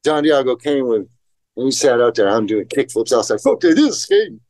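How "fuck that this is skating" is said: put-on pitched-up bratty kid scoffing; sulky tantrum. Young skater outside doing kickflips in a sulky tantrum. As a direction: brush off the ramp like a pouting kid, higher. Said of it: "temper tantrum".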